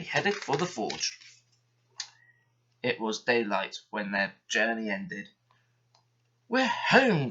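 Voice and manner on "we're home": glad cry. A narrator's reading. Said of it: "cried Douglas".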